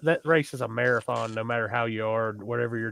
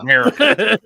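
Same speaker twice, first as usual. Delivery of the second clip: mock proud; 11 semitones higher, 12 dB louder than usual. Real, not acted. Joking echo, laughing.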